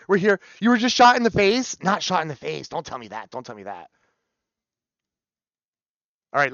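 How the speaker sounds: normal talking voice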